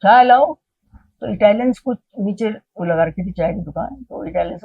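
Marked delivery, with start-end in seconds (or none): 0.0-0.5 loud, higher-pitched order; Baba Ji. Retold or imitated. Guru ordering tea for his guests, calling out. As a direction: call out for something to be brought. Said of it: "इतने ही में कहते हैं".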